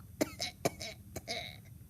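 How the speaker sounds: acted run of coughs; put-on sickly cough; Grandma's sick cough. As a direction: cough as a pretend invalid. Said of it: "Said Grandma".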